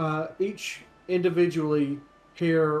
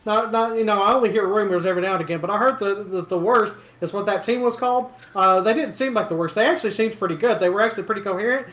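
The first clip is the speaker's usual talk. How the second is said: lightly voiced townsperson, higher and louder, rambling on; gossipy local getting worked up, slightly raised voice; chattering rumor-monger; 5 semitones higher, 7 dB louder, much faster than usual. Local recognizing Horton from the arena, lightly excited chatter.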